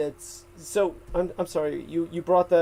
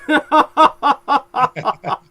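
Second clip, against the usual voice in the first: loud laugh; 11 semitones higher, 9 dB louder than usual. Real, not acted. Laughs after awkward remark.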